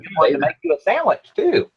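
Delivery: put-on airy Valley Girl lilt, rising at the end. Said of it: "She said she likes my Valley Girl impression".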